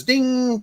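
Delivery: mock quiz-show ding. Awarding points like a quiz show.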